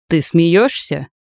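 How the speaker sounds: slow, lightly voiced domineering uncle; a hint of a commanding uncle, drawn out slowly; uncle's sharp commanding voice. A narrator's reading. Uncle coldly questions his niece, a touch of command. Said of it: "Голос дяди оказался неожиданно резким, властным".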